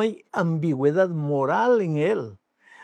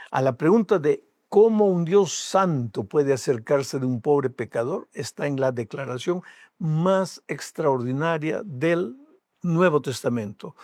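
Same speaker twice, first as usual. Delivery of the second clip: ordinary talk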